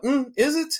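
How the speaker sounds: high-pitched, doubtful questioning; retold doubt. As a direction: question it sceptically, voice lifted high. Acted doubt answering friend's enthusiasm.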